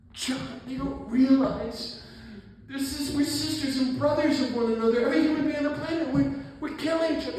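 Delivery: high, loud, overwrought cry of grief; imitated sobbing plea. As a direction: act out a tearful outburst, high-pitched and pleading. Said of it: "he starts sobbing. He goes".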